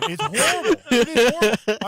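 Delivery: very high, loud cry of disbelief; exasperated outburst. Real, not acted. Complaining to podcast friends about the dub, exasperated. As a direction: burst out high and loud in comic outrage.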